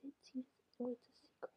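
secretive whisper. Acted. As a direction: hush it to a whisper. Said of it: "I can't quite hear what you're saying".

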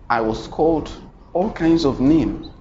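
ordinary delivery

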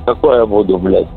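ordinary talk, nothing marked

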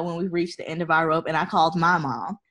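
ordinary talk, nothing marked

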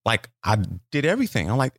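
usual speaking voice

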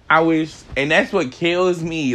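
normal talking voice

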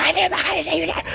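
squeaky, very high imitation of a shouting mom; mock motherly yell. Podcaster imitates mom yelling him down to breakfast, high mock yell. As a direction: fake a mom's yell in a thin falsetto. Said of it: "me gritaba y me decía".

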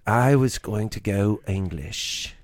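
slow, lightly put-on pirate English; pirate accent. Son slowly putting on a pirate accent for the show. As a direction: drag it out like a pirate playing at English. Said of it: "Like a pirate".

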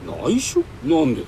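startled, demanding why; surprised. Acted. Surprised at her secrecy. (驚いて問い返す)

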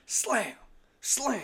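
high, sharp sound effect; door slam noise. Retold or imitated. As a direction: mimic the slamming door. Imitating door slams.

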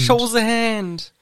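high, slow, drawn-out urging; hammed-up exasperated plea; mock pleading. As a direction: implore him slowly and high in playful English. Urging Harry, mock English.

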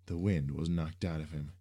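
usual speaking voice

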